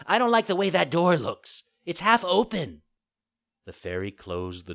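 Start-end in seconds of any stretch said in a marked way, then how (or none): 0.1-2.8 high, loud whiny puppet; fussy complaint. A narrator's reading. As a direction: find fault like a spoilt child. Puppet makes excuses.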